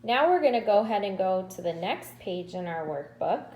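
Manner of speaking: ordinary talk, nothing marked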